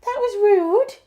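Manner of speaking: very high, loud mock telling-off; pet scolding. Real, not acted. Owner to barking dog, high pet scolding. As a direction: squeal it at the naughty dog.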